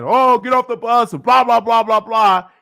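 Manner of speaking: mock drill-sergeant shout, high and loud; loud, high-pitched mock barking; yelling drill sergeant. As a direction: bellow orders like an imitated sergeant. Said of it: "the drill sergeants yelling at him".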